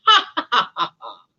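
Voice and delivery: loud stagey ha-ha-ha, falling; mocking laughter. Poachers leaving for lunch, laughing scornfully. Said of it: "The poachers walked away laughing".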